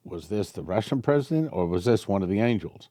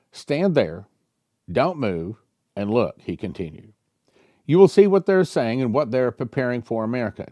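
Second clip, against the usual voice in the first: raised, dramatic scripture-style reading; lightly heightened prophetic reading; reading prophecy aloud; 3 semitones higher, 3 dB louder than usual. Acted. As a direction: read the vision out like a revelation. Reads prophecy, angel quotes.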